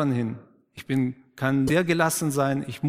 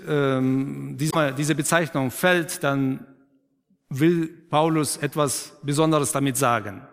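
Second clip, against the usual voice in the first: ordinary delivery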